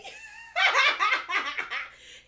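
loud high burst of laughter; big laugh. Real, not acted. Cracking up at her own joke, big loud laugh. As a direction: let the laugh explode out.

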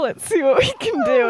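high, sly and giddy, plotting mischief; sneaky Marauder voice. Retold or imitated. Marauders plotting in the common room, sly and giddy.

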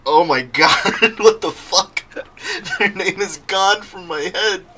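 loud, high, cracking up in disbelief; laughing exasperation. Real, not acted. Podcast host who just lost a creator's name, laughing exasperation. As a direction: exclaim through helpless laughter at yourself.

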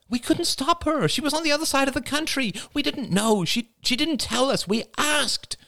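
high, pleading defence; pleading defensive woman. A narrator's reading. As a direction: plead that you tried. Marie defends herself.

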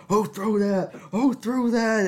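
high, loud mock yell; Bryce's angry yell. Hit in the head by the ball, Bryce yells at classmates in a high, loud mock rage. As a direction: imitate a furious kid yelling at everyone. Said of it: "He started yelling".

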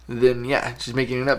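usual speaking voice

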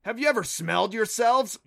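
indignant, disgusted character voice; disgusted scoff. Acted. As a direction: scoff loudly at the very idea. Creature mocks how they smell.

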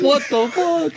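squealed, high, laughing; laughing shriek after a tree smacks his face; laughing disbelief. Retold or imitated.

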